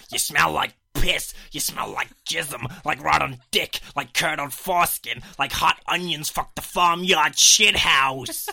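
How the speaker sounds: hammed-up accented tirade, building to a shout; Robert Pattinson film accent. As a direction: spit out each insult, stretching the words. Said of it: "just in case that accent was undistinguishable".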